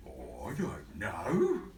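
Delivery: slow, lumbering elephant voice. Acted. Elephant slowly admitting he doesn't know. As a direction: drag it out ponderously.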